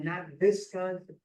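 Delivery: "Visconsin" with a V in place of the W; mock his "Visconsin", V sound up front; imitating father's mistake. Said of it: "He'd say Visconsin".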